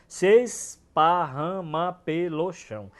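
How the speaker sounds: "Se esparrama" drawn out slowly, "se" and "es" merged; slow scansion. Shown as a demonstration. Teacher shows the syllable elision, slow and deliberate. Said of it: "Acontece aí a elisão silábica".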